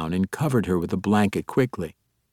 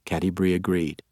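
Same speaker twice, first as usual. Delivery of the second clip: plain reading voice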